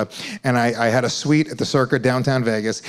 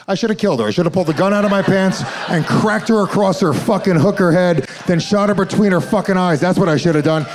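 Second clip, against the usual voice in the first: mock psycho rant; 5 semitones higher than usual.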